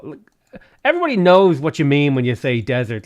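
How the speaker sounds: ordinary talk, nothing marked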